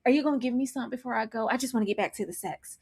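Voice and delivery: soft, quick imitation of wheedling; hushed, pestering mock plea; mock begging. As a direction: murmur a hurried plea, mimicking. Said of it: "He's begging because we have five minutes left".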